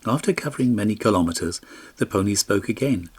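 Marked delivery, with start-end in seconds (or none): none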